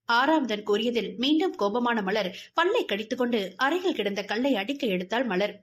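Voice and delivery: ordinary delivery, nothing marked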